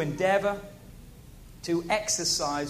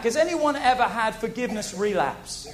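usual speaking voice

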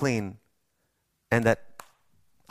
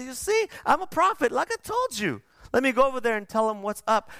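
very high, quick swaggering boast; cocky Jonah; 11 semitones higher than usual. Retold or imitated. Jonah out of the fish boasting to God, very high and cocky. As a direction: strut like a prophet who was right all along.

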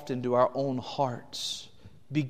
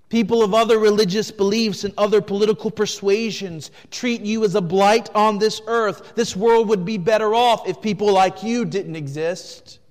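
louder and higher, driving; full-force preaching; 7 semitones higher, 10 dB louder than usual. Acted. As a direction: preach it to the back row.

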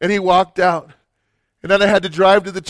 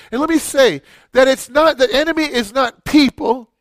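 usual speaking voice

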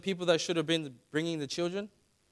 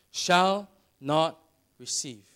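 "Shall not receive" drawn out slowly, weight on "Shall"; slow, stressed "shall not"; 3 semitones higher, 5 dB louder, much slower than usual. Shown as a demonstration. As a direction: lean hard on "Shall", then let "not receive" follow slowly. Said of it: "You notice this word".

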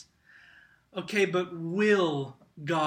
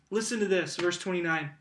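usual speaking voice

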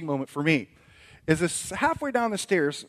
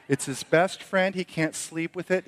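ordinary delivery

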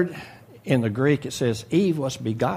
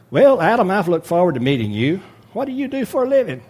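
higher, louder salesman charm, quicker at the end; a hammed-up slick charmer greeting a mark; smooth-talking snake; 6 semitones higher, 5 dB louder than usual. Snake buttering up Adam, loud and high-pitched.

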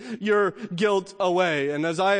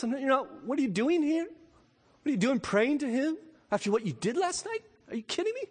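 a taunting inner voice, pitched up and hushed; accuser's voice; 7 semitones higher, faster than usual. Acted. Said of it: "You ever hear that voice? ... your accuser's voice".

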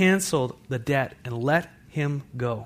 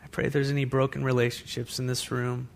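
closing prayer; 6 dB quieter than usual. Acted. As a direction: pray quietly over the room.

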